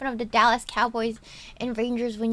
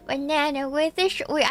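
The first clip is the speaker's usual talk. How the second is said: normal talking voice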